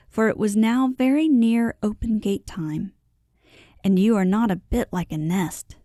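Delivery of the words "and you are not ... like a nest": ordinary delivery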